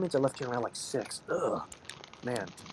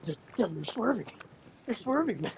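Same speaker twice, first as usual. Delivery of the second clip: alarmed warning; 8 semitones higher than usual. Retold or imitated. Warning sleepy driver swerving.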